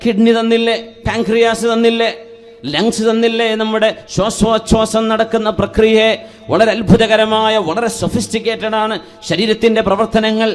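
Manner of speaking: high, insistent sermon voice; impassioned preaching. Real, not acted. Preacher listing God's gifts to the congregation, impassioned. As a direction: press each question on the congregation.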